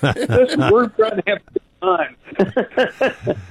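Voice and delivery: put-on high, laughing teenage-girl whine; daughters' whiny protest. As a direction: play the embarrassed daughter, laughing. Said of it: "they go".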